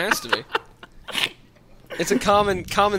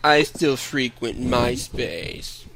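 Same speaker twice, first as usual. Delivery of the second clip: slow cartoon hound with a slight lisp; slow, drifting hangdog impersonation; Droopy the Dog; much slower than usual. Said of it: "I'm getting far away from it. I'm giving him, like, a lisp".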